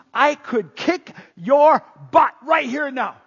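slow, high, loud warning; forceful threat. Acted. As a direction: punch out the threat word by word. Jesus warns the guards of his power.

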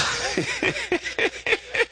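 high-pitched chuckling fit; a run of high giggles; laughing. Real, not acted. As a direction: break into high, helpless laughter. Amused at his own story.

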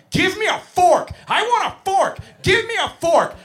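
put-on high-pitched toddler tantrum yell; screaming three-year-old. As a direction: scream a demand like a furious preschooler. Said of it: "I'm being screamed at point blank".

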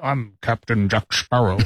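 low Sean Connery-like pirate voice; Johnny Depp. Acted. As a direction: introduce yourself as a low-voiced pirate captain. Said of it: "Johnny Depp".

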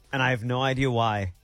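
ordinary talk

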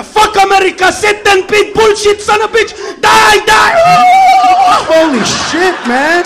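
high, loud ranting shout; mock fury at America, yelling; hammed-up screaming tirade; angry rant. Acting angry foreigner ranting.

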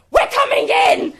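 very high, loud, slow explosive shout; full mock scream. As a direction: explode in frustration. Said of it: "she absolutely explodes and shouts at her".

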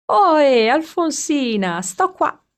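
high, loud hail across the road; boy calling his friend from down the road, loud. A narrator's reading. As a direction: wave her over with the voice.

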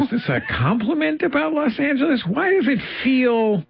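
high-pitched incredulous questioning; mock indignation. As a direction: act out baffled outrage, voice shooting up. Acting out baffled reaction to remark.

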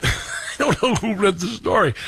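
chuckling through the words; laughing. Real, not acted. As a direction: say it while laughing.

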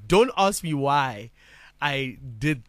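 ordinary delivery

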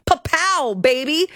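high, taunting mock seductress; mocking Hajini. Reading the complaint, mocking Hajini's taunt at the husband. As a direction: ham up a crude taunting temptress. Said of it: "insulted plaintiff's wife".

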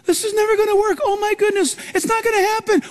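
high, anxious mock fretting; high-pitched fretful whine; fretful worrier. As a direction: play the panicky worrier. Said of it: "Those of you who have a tendency to fret".

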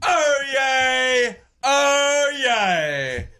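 slow, rolling British-style proclamation; fancy yourself up like an English crier; British town crier. Pretend. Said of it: "Why are you sounding British?".

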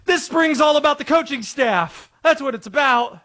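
parroting the PR line; Vrabel inner voice. Retold or imitated. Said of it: "He caught himself in the middle of the answer saying".

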